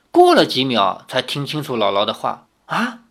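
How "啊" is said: stammered question, pitched well up; stunned. A narrator's reading. Young man startled by sudden talk of marriage, stunned. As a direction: blurt it higher, tripping on the word. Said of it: "李逍遥一愣".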